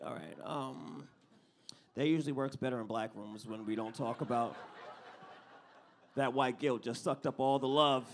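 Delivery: ordinary delivery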